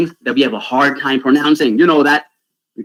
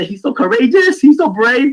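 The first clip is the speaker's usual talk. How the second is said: ordinary talk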